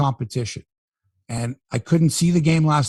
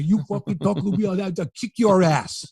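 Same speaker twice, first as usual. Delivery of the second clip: mock Philly tough-guy accent; 4 semitones higher than usual. Imagined Philly local threatening the host, mock Philly accent. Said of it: "someone from Philly who would come over and say".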